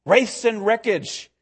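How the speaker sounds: ordinary delivery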